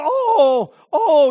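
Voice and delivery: loud grief-stricken oh; grieving father's cry. Retold or imitated. Father hugging his son's casket, loud anguished cry. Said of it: "he just crying out".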